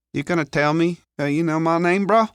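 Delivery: high-pitched street lad; Leon, questioning. Acted. Figure in narrated story.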